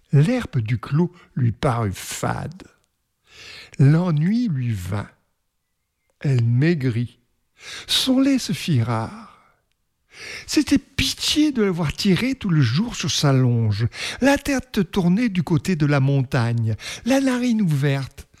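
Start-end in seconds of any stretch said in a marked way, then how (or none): none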